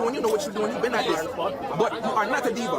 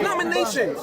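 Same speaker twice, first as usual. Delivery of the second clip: ordinary talk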